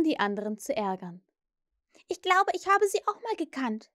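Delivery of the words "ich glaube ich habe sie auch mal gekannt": put-on high-pitched, tentative little boy; small child's hesitant claim; slightly unsure. A narrator's reading. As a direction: chime in a little uncertainly. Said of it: "sagte Michael etwas unsicher".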